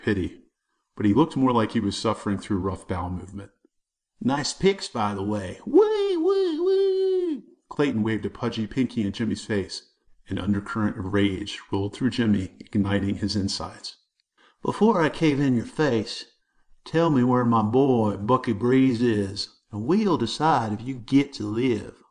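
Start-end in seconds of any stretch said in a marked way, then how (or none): 4.2-7.4 mocking squeal. A narrator's reading. Clayton mocking Jimmy with a very high squealing wee wee. 14.6-22.0 bully's threat. A narrator's reading. Clayton threatening Jimmy about Bucky Breeze, slow and menacing.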